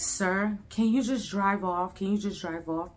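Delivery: low, soft, weary begging; hushed plea. Retold or imitated. Pleading with the driver.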